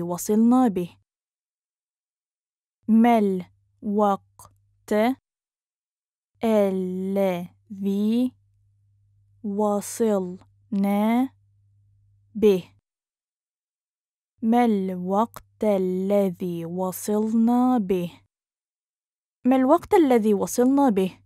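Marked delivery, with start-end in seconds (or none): 2.9-12.6 very slow, broken into separate syllables. Shown as a demonstration. Teacher breaks phrase into syllables. 14.4-18.2 measured, careful teaching pace. Shown as a demonstration. Teacher repeats phrase slowly joined. 19.4-21.2 fast, higher and fluent; quick, lifted model sentence; natural speed. Shown as a demonstration. Teacher repeats phrase at speed.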